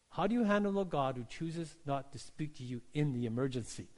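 ordinary talk

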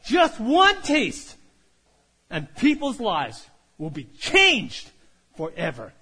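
slow, loud, drawn-out promise; slow preaching. Acted. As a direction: preach it out with weight. Preacher's key line.

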